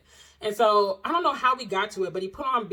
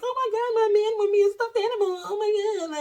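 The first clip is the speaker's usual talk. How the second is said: much higher, squealing delight; gushing; 13 semitones higher, 4 dB louder than usual. Retold or imitated. Girlfriend gushing over her stuffed animal, high and thrilled. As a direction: gush like a smitten girl over a prize. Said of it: "And I'm like".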